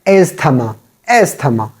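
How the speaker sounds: "asthma" with the th sounded; "asthma" in three syllables with a t sound; mispronounced asthma. Shown as a demonstration. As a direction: say "asthma" as es-tha-ma. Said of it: "it is not asthma".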